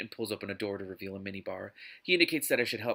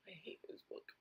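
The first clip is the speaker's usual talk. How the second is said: breathy, toneless whisper, much softer; whispered aside; 7 semitones higher, 20 dB quieter than usual. Real, not acted. Reader griping about the book, whispered under her breath. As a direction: whisper it as a fed-up secret.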